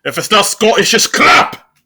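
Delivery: hammed-up Scottish rant; Scottish accent. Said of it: "He loves a good Scottish accent".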